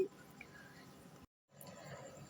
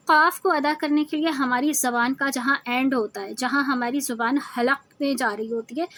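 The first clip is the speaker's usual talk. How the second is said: ordinary talk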